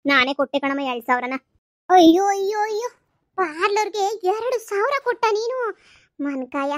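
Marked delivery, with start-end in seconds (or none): 1.9-2.9 shocked wail. Acted. Mother shocked by money spent. 3.4-6.7 aghast mother. Acted.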